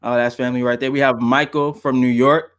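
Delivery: ordinary talk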